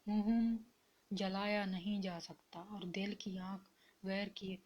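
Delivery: ordinary delivery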